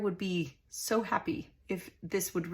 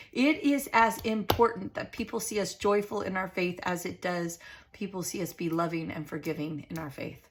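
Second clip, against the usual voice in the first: usual speaking voice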